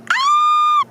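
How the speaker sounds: sudden high shriek of shock; shocked scream. Real, not acted. Reader reacts to a twist with a high scream. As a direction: let out a short startled scream.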